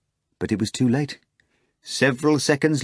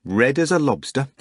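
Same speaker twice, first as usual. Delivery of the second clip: plain reading voice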